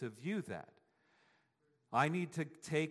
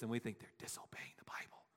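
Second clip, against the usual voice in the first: whispered aside; 5 semitones lower, 10 dB quieter than usual. Real, not acted. Aside about judging others.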